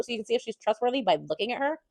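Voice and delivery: ordinary delivery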